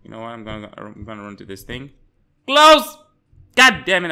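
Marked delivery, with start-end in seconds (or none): 2.5-3.0 high, loud yell of alarm; loud shout. Real, not acted. Gamer nearly caught, high loud yell. Near miss in chase. 3.5-4.1 loud annoyed curse; frustrated yell. Real, not acted. Gamer cursing a near miss, loud.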